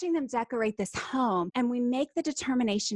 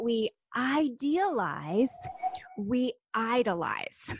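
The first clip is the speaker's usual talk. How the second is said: "idealize" and "idolize" stretched out, with a pause between; slow and distinct; much slower than usual. Shown as a demonstration. Guest repeating her key distinction to the host, slow and distinct. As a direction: set the two words apart, slowly. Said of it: "say it again slowly".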